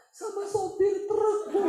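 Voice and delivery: high, loud, drawn-out comic complaint; mock confession. Woman blaming a truck driver to the pastor, hammed-up. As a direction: ham up the woman's answer, slow and high.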